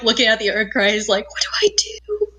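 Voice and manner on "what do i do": panicked breathy whisper. Retold or imitated. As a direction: hiss it out in a panic. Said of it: "I'm like".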